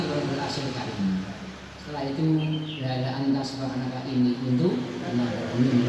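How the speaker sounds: measured chanting of an Arabic prayer; recited Arabic supplication; prayer recitation. Shown as a demonstration. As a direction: recite the dua devoutly.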